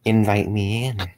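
slow, drawn-out monster voice; creepy whisper. A narrator's reading. Monster at the hospital window taunts the father, creepy whisper. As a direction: savour it slowly, like a taunting creature. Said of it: "and whispers".

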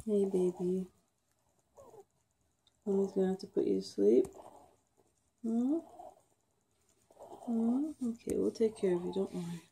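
soft, gentle words to a dying pet; tender. Real, not acted. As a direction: comfort her quietly. Telling the dog she'll be put down.

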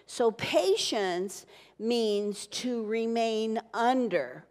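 drawn-out teaching line, stressing patience; slow, deliberate definition; slow emphasis. Shown as a demonstration. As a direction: lay it out slowly, word by word. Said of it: "slow teaching emphasis".

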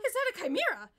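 high, loud alarmed scream; a loud, high cry of alarm; screamed question. A narrator's reading. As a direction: shriek it at the monster. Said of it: "Claire screamed".

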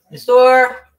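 loud sudden bark; sharp shout. Retold or imitated. Mother retelling scolding daughter, loud shout. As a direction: snap at the kid.

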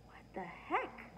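puzzled breathless whisper. A narrator's reading. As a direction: whisper it, baffled. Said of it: "Daddy said".

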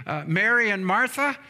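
ordinary talk

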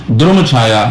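ਦ੍ਰੁਮ with d, r and u blended, no vowel after d; pronunciation demo. Shown as a demonstration. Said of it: "ਦ੍ਰਮਨੀ ਇਹਦਾ ਪਾਠ ਕਰਨਾ".

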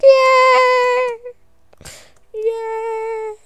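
mock cheer. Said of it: "And you're gonna be like".

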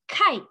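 "Kite" as a single high, short beat; one-syllable beat. Shown as a demonstration. Teacher blinking along with the class, "Kite" as one beat. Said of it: "So let's do this together".

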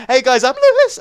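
high, loud YouTuber greeting; squeaky intro. Shown as a demonstration. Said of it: "what just happened to my voice".

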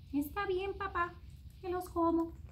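put-on high reluctant child voice; child giving in. A narrator's reading. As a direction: give in grudgingly like a kid. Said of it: "said to papá".

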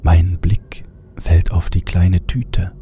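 ordinary delivery

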